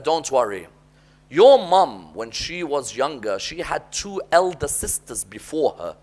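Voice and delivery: father quietly explains the mother's tears; calm comfort. Retold or imitated. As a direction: gently settle the boy's worry. Father calmly comforting son.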